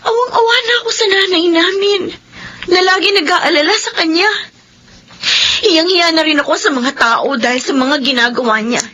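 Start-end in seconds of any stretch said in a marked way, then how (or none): 0.0-4.3 higher, voice near crying; tearful. Acted. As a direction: let the pity for mother break through. Sister confides about her mother.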